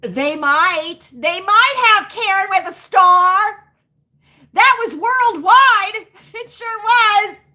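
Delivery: loud, high and drawn-out; big, emphatic, amused boast; excited exclamation. Real, not acted. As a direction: crow it out with rising excitement. Exclaiming about a worldwide event.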